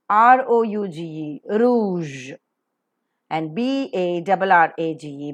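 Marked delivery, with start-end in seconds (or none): 1.5-2.3 "rouge" as roozh with a long oo; practice model pronunciation. Shown as a demonstration. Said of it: "Let's practice".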